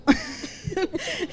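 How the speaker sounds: very high giggle; laugh. Real, not acted. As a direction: giggle at the answer. Ríe al contar su respuesta. (risita muy aguda)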